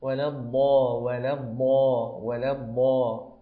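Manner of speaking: a thick ض from the tongue's edge in الضا; side-tongue dad demo. Shown as a demonstration. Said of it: "تركز على إحدى الحافتين في نطق حرف الضاد".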